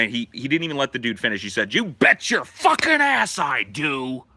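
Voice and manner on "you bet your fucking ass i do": slow, loud, gruff angry retort; angry Santa cutting him off; pissed-off Santa. Retold or imitated. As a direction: bark it back at the union man. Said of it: "and he's pissed".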